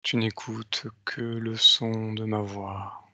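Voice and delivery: slow, soft hypnotic countdown; slow hypnotist voice. Acted. Leads a hypnotic induction. (voix lente d'hypnotiseur)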